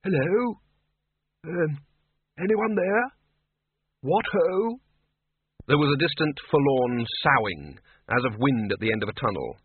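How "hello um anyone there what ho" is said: put-on high creaky old man's voice; chirpy doddering wizard; high thin old man, chipper; old Windle. A narrator's reading. Windle calling out.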